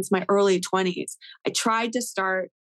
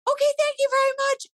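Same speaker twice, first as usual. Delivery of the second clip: much higher and faster mock-polite thank you; quick polite exit; faster than usual. Handing back the mic to flee, high hurried thank you. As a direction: chirp it out and bolt. Hurried sign-off after rap.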